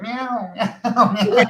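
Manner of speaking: high mock meowing, a touch of laughter; playful cat impression; imitating the cat. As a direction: play the cat's nagging meows, lightly. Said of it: "I've got Bob down here going".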